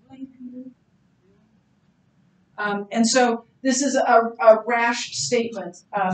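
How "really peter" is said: softer, wry reply; soft and dry. Retold or imitated. Jesus answering Peter's boast, soft and wry. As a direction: undercut him gently.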